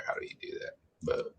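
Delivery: whispering to herself; breathy whisper under her breath; whispered aside. Real, not acted. Muttering to herself at computer.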